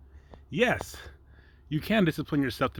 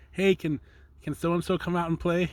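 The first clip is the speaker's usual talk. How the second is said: put-on kid calling; 7 semitones higher than usual. Kids calling at a friend's door.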